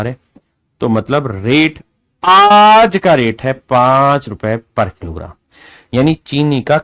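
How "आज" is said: आज loud, with a long held vowel; emphatic stretched आज. Shown as a demonstration. Teacher announcing today's rate per kilo, आज loudly drawn out. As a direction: lean hard on आज and stretch it. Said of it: "जोर से बोलो, आज".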